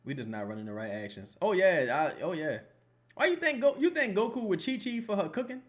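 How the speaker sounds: usual speaking voice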